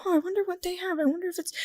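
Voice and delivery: high, soft inner voice; curious wondering. Retold or imitated. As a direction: wonder privately in a small high voice. Voices her private curious wondering.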